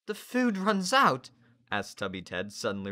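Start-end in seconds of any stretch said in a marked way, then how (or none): 0.1-1.3 put-on alarmed boy. Tubby Ted alarmed.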